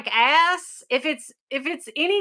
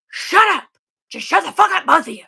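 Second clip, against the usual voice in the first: higher, louder annoyed snap; irritated yell; 5 semitones higher, 4 dB louder, faster than usual. Retold or imitated. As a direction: snap at them to shut up. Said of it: "he'll go".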